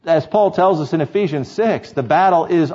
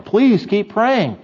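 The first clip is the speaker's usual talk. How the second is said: higher, drawn-out plea; begging; 7 semitones higher, much slower than usual. Acted. Panicked people begging Samuel to pray, drawn-out plea. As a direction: beg with a long, high "Please". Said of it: "people are begging him to keep going".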